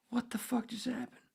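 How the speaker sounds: very high, thin incredulous voice; retold stunned disbelief. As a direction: act out reeling in shock.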